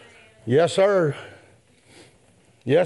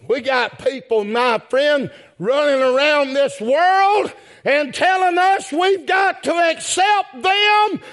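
preaching at full force; 10 semitones higher, slower than usual. Acted. Preacher to congregation, crying out against the world's demands. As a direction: build from speech to a full preacher's cry.

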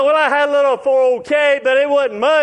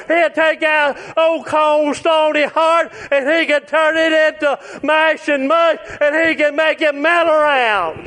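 preaching at full force; 5 semitones higher than usual. Acted. Preacher urging the congregation to give their hearts, fervent. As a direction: press it on the crowd with a rising preacher's drive.

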